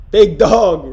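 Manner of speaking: loud big-dog holler; hyped-up boast. Pretend. Streamer boasting about handling the hate, loud hyped-up holler. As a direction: belt it out like a hype call.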